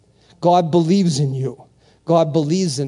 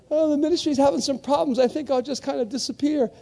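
mock timid quitter; 8 semitones higher, faster than usual. Faint-hearted member slipping away, mock timid voice. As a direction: shrink away in a small high voice.

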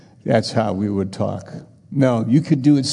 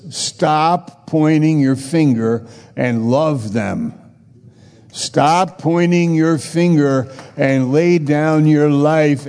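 slow, pressing preacher's plea; slow, emphatic sermon climax; preaching exhortation; 5 semitones higher, 3 dB louder, slower than usual. Acted. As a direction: lean on every word, drawing it out. Slow urgent exhortation to congregation.

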